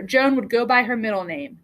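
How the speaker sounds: plain reading voice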